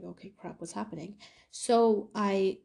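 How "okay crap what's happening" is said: much softer, hushed panic under the breath; softly voiced rising panic; panicked hush. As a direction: hush it so the class won't hear. Panicked aside in class.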